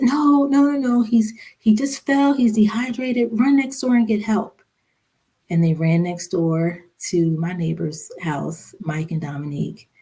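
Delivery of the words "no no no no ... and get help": high, urgent retold insistence; mother taking charge, retold; urgent reassurance. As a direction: reassure the child with raised pitch, pressing on. Retold reassuring frightened son in emergency.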